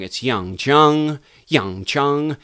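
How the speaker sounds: "Yang Chung" said slowly, the tone of "Chung" rising; variation on Chung. Shown as a demonstration. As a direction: lift "Chung" up at the end. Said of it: "a variation within Chung".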